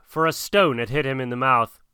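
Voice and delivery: ordinary delivery, nothing marked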